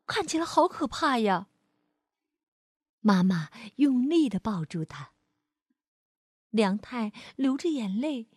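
put-on gentle storyteller reading to a child; lively read-aloud for kids; expressive bedtime-story voice; story narration. A narrator's reading.

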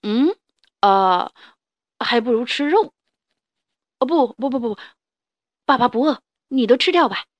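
loud, strained dinosaur voice; gagging on grass, then covering; T-rex voice. Acted.

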